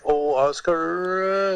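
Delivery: high, very slow whimpering "Oh, Oscar"; starting to cry. Retold or imitated. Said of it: "and he starts to cry".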